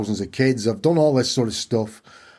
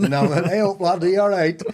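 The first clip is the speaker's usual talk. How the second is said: much higher, cheery Yorkshire hello; a chirpy Yorkshire God answering back; bright Yorkshire "hey up, lad"; Yorkshire greeting; 9 semitones higher than usual. Retold or imitated. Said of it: "God's from Yorkshire".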